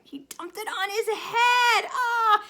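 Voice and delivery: high, loud hammed-up dismay; playful mock outcry for kids; mock shock. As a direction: gasp at the naughty monkey.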